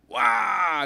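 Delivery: high loud crying wail; child's wail. Acted. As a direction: bawl like a smacked kid. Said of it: "the boy cried".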